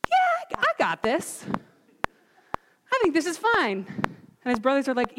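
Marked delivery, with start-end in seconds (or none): 0.1-3.8 lightly mocked youthful cockiness; young David volunteering. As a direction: pipe up high like an eager kid. Young David volunteers, voiced by preacher.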